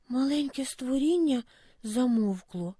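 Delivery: ordinary delivery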